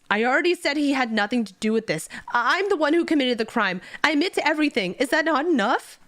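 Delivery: red-eyed, admitting guilt to save his master; desperate shout. Acted. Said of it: "Jang Yu's eyes go red and shouts".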